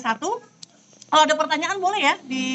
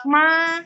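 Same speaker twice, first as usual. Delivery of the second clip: "ma" long, high and level; first tone; 3 semitones higher than usual. Shown as a demonstration. Teacher demonstrating tone one for Dina, sustained level "ma". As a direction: hold "ma" steady on one note. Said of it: "coba yang nada satu".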